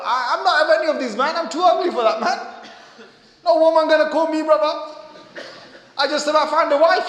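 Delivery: laughing self-mockery. Voicing a self-mocking brother.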